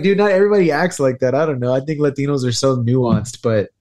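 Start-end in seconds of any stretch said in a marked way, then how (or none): 0.0-1.3 higher, fast exasperated protest. Real, not acted. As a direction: throw up your hands, it's not everyone. Exasperated rant about stereotypes.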